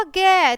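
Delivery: loud "गे" with a clear ए ending; vowel sounded out. Shown as a demonstration. Teacher answering own question for children, emphatic "गे". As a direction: hit "गे" hard and sound its ए. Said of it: "ए की ध्वनि सुनाई दे रही है".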